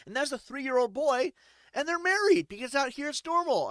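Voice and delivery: a hint of a breezy high local; mock casual explaining; lightly voiced local. Locals shrugging off the newcomer's shock, lightly voiced. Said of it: "They're like".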